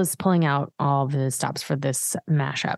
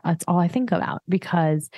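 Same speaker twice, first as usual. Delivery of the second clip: normal talking voice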